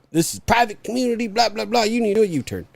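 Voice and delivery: high mock guard voice; prissy guard. Guard reciting the rules to the driver, high and mocking. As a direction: parody the guard's rule-reciting. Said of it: "And he's like".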